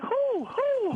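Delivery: very high-pitched mock questioning; mock challenge. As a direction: throw each question up high, imitating a heckling challenge. Imitating Patrice challenging her claim.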